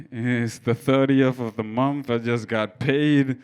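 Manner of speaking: usual speaking voice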